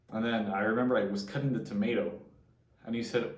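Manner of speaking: ordinary talk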